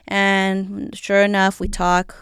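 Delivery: ordinary talk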